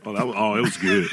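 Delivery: ordinary talk, nothing marked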